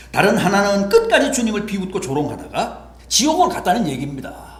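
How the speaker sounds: high, loud pulpit proclamation; fervent preaching. Acted. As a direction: preach the contrast with full force. Preaching at full force.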